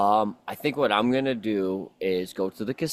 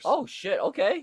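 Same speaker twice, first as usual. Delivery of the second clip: excited. Real, not acted. Excited about doubled winnings.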